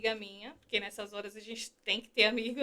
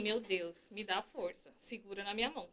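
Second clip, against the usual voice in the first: quiet prayer; 3 semitones lower, 15 dB quieter than usual. Retold or imitated. Desperate prayer in the car.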